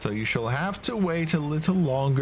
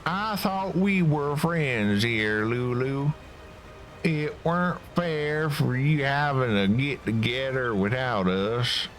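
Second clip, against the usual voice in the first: put-on southern accent; 4 semitones higher than usual. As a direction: drag it out with a southern twang. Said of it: "Perry is a southern accent".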